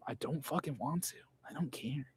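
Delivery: breathy whispered aside, no voice. Real, not acted. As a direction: drop to a bare whisper. Defiant rant drops to whisper.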